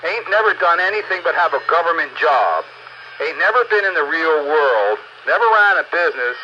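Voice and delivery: ordinary talk, nothing marked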